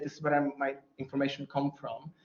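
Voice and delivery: usual speaking voice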